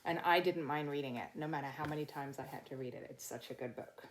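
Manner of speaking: ordinary delivery, nothing marked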